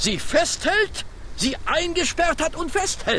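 high, rising insinuation; insinuating. Acted. Detective needles the servant about his master, pitched high. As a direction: pile on the accusation, climbing high. Fix presses accusation, voice climbing.

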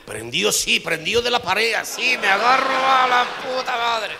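high, loud, slow strained groan; clinging to the wall in pain; hammed-up agony; mock straining.

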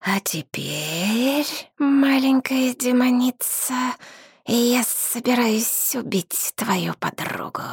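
high, slow hissing dragon voice; hissing reptile growl. A narrator's reading. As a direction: hiss each word like a cruel dragon. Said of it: "прошипел дракон".

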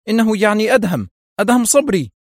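high, loud burst of alarm; panicked cry. A narrator's reading. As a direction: cry out as horror dawns. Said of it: "وصاح بذعر".

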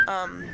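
high whistling flute note; flute imitation. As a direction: mimic a flute with your voice. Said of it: "That's me being a flute".

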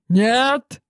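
shout. Acted. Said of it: "крикнул тогда ректор".

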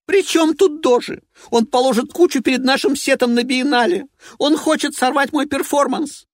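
high, loud and outraged; a furious performer complaining; shrill diva voice. Acted. Evelina voiced.

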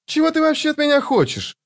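very high-pitched indignant question. A narrator's reading. Girl demands what the thief wants from her, indignant. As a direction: throw it back at him, outraged. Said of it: "возмутилась Ваза".

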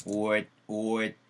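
Would said as "oet", rounded start with a t ending; oet sound. Shown as a demonstration. Teacher drilling would as oet, rounded and clipped. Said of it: "제가 would가 아니고 제가 뭐라 그랬어요?".

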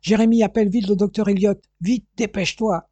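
loud, high, panicked orders; frantic father yelling to his son; urgent shout. Acted. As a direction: bark for the doctor in alarm. Father finds empty sleeping pills.

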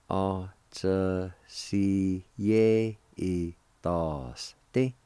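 slow, separated syllables with pauses. Shown as a demonstration. Teacher showing learners the word jaunt, syllable by syllable. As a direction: sound out each syllable slowly on its own. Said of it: "It is pronounced like this".

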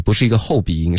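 ordinary talk

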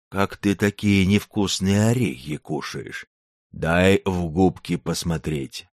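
slow, simple-minded giant; a dull giant wondering aloud; dim slow booming giant. Acted. Giant asks slowly.

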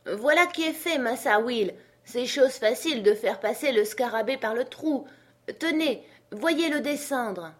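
high character voice; obliging servant. Acted. Jupiter lowers the beetle for his master, pleased. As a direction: call down that it is done.

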